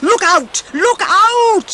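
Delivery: high, loud alarm call; a dwarf warning his friends to take cover; warning shout. Acted. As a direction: yell a warning to the others. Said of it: "he shouted to warn the others".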